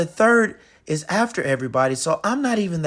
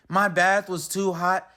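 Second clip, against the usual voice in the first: ordinary delivery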